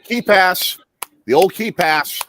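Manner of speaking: high, loud cheer for the pass; excited call-out. Real, not acted. Fan watching the game calls the key pass, excited. Calling out a play while watching.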